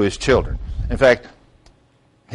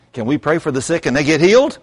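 ordinary talk, nothing marked

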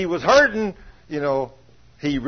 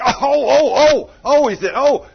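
loud, high pained oh-oh cries; mock groans of pain; 9 semitones higher, 9 dB louder, slower than usual. Husband groaning as the devil squeezes tighter, loud mock pain. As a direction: cry out as if the back pain just hit.

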